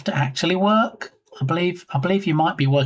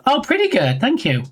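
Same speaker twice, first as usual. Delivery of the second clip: pleased surprise; 7 semitones higher than usual. Real, not acted. Delighted at praise.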